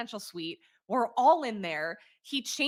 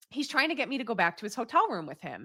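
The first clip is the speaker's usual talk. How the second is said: ordinary talk, nothing marked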